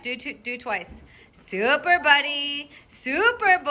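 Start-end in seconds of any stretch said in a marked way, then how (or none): 1.3-3.7 high, slow sing-song praise; high happy praise for the puppy; dog praise. Real, not acted. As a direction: cheer the dog on brightly.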